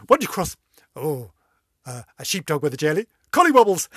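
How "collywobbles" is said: high, loud punchline burst; blurting the answer to her own joke, excited; gleeful punchline blurt. Real, not acted.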